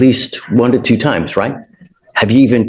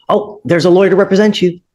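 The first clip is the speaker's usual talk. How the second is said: much higher, sing-song mock of wishful thinking; mocking naive hope; 8 semitones higher, faster than usual. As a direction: mimic someone expecting a lawyer to appear by magic. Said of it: "poof, it doesn't magically appear".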